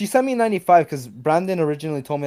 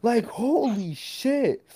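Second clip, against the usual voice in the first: high, soft and very drawn out; awestruck; 7 semitones higher, 6 dB quieter, much slower than usual. Retold or imitated. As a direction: let the amazement stretch out slowly, high-pitched. Retold shock at seeing money sent.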